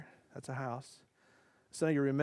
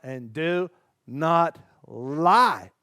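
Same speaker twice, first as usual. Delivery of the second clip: louder, slow, grave intoning; solemn proclamation; 8 dB louder, much slower than usual. Acted. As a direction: proclaim it like scripture. Dramatic final commandment.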